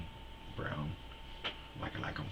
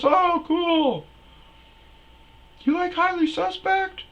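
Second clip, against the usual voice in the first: put-on very high, loud falsetto girl; high girly voice; 11 dB louder than usual. Comic voicing the concert girl, very high falsetto. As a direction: shriek it like a bubbly girl.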